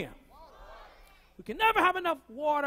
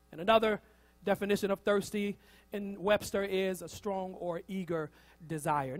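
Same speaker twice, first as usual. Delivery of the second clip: ordinary delivery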